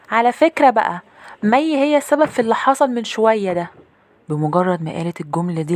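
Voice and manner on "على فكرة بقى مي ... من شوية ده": put-on high little-girl accusing voice; ميرنا. Little sister blames her sister before Mum and Dad, high accusing voice. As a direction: tattle in a high-pitched child's voice. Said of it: "لقيت أختي ميرنا بتقول لماما وبابا".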